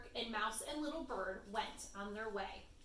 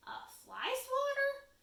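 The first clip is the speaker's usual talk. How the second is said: surprised mouse; 14 semitones higher, 4 dB louder than usual. Acted.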